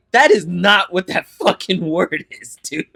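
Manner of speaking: slow, loud, incredulous correction; mock outrage at a friend's slip; exasperated retort. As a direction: tell her off, half laughing. Correcting friend's wrong word.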